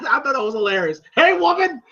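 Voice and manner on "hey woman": high-pitched mock holler; amused replay of a rude call; Mister T. Repeating Mister T's call to Adrian, mock holler.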